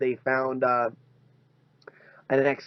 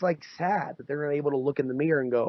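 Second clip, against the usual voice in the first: ordinary talk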